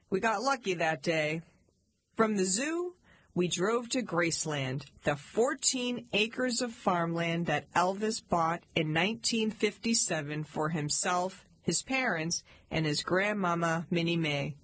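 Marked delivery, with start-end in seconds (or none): none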